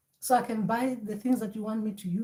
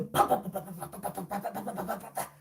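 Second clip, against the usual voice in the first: drumbeat imitation; 4 dB quieter, faster than usual.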